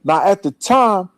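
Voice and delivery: usual speaking voice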